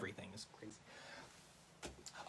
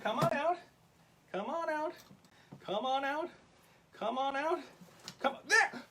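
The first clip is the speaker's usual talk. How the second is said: higher, softer come-on-out; coaxing; 6 semitones higher, 7 dB quieter than usual. Acted. Host coaxing the rabbit out of the bucket, soft and high. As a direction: gently lure a pet out.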